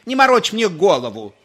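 high, loud scolding; annoyed woman shouting; irritated wife. A narrator's reading. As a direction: snap back from the other room. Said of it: "закричал женский голос".

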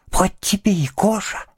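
ordinary delivery

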